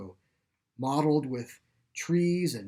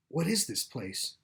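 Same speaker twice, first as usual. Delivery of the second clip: softly muttered, half to himself; 6 dB quieter than usual. A narrator's reading. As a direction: mutter it to yourself, taking in the strange room. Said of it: "Joel muttered".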